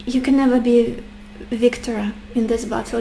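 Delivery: ordinary delivery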